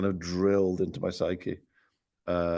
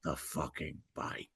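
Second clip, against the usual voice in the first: slow, softer mock Cage line; a lower, slow replay of the Cage quote; Nicolas Cage impression; 5 semitones lower, 5 dB quieter, slower than usual. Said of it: "The Wicker Man".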